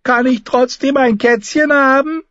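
put-on small, high, pleading child voice; near tears. A narrator's reading. Boy asks his mother for a kitten, high and nearly tearful. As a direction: beg as a boy close to crying. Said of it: "schien den Tränen nahe".

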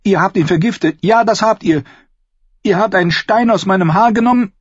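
high, loud horrified accusation; accusing. Acted. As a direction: confront him, shaken and loud. Sansa accuses Dontos of poison. (hoch und laut, entsetzt anklagend)

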